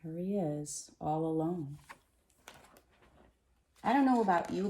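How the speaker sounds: ordinary delivery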